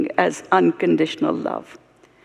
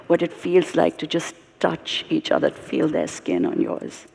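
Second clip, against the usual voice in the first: hushed and tender; 2 semitones lower, 9 dB quieter than usual. Real, not acted. Urging couples to touch again, hushed.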